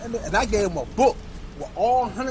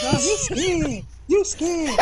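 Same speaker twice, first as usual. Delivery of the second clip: put-on teasing kids; 9 semitones higher, much slower than usual. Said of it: "They like".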